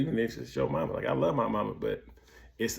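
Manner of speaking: ordinary talk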